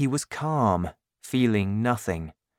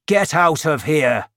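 loud, raised-pitch angry order; shouting; 4 semitones higher, 6 dB louder than usual. A narrator's reading. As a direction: shout to chase the child off. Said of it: "and shouted".

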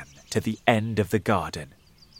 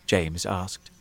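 ordinary delivery

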